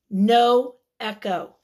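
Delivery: usual speaking voice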